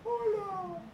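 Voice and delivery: high, soft little voice answering; a shy kid echoing back; child's reply. Retold or imitated. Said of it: "y el niño". (respuesta tímida de niño, aguda y suave)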